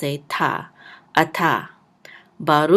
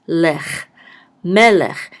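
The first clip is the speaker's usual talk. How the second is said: melech with the stress on mel, louder; stress on first syllable; 7 dB louder, slower than usual. Shown as a demonstration. Teacher stresses the first syllable of melech. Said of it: "the accent mark is on the first syllable".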